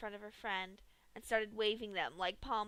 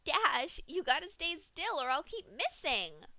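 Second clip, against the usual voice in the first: high, louder chiding Pinkie voice; scolding Pinkie; 7 semitones higher, 6 dB louder than usual. A narrator's reading. Pinkie scolding the thrashing Dash, high and loud. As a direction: scold like a cheery nanny. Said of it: "scolded Pinkie".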